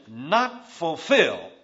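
"not fulfill" drawn out very slowly, with weight on "not"; slow right down and lean on "not"; stressed phrase. Shown as a demonstration. Preacher spelling out the double negative to the congregation, slow and stressed. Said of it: "The word, the expression".

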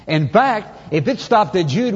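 ordinary delivery

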